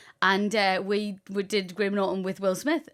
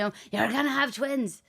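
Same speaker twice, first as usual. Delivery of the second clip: slow, lightly put-on broad Irish accent; 5 semitones higher, much slower than usual. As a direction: drag it out in a mid-range Irish accent. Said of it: "I knew you would do her accent".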